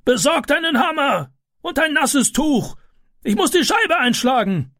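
high, commanding shout; man directing helpers in a high, urgent voice; neighbour shouting orders. A narrator's reading. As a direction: take charge of the crowd at the fire.

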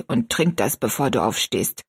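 put-on low old woman's voice; kindly old woman. Old woman bringing tea to a girl's bed, kindly and low. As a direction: coax the child kindly, pitched low.

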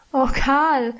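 lightly voiced lament to her brother; wailing. A narrator's reading. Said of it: "jammerte ich".

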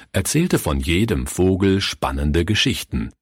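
ordinary delivery

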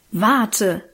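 mild threat, read out firmly; a touch of menace in a narrated warning; threatening. A narrator's reading. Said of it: "drohte er".

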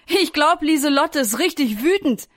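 put-on mildly laughing storybook boy; amused, laughing remark. A narrator's reading. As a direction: say it with amused glee. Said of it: "lacht Nico".

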